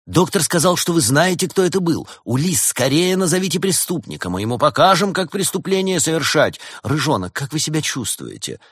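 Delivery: high, loud excited clamour; agitated guests. Acted. Agitated guests burst in demanding the culprit's name, excited. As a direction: fire off urgent questions, pitched high. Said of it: "вбежали взбудораженные постояльцы".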